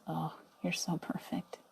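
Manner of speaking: slow, soft, breathy whisper of praise; tender whisper. Pretend. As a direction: whisper it like a loving inner voice. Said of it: "Your higher self looks upon you and goes".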